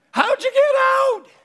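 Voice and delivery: high, loud, incredulous squeal; a high amazed yelp at the escape; astonished cry. Acted. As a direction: shriek it in mock disbelief. Mock astonished question to apostles.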